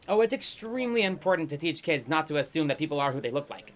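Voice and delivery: normal talking voice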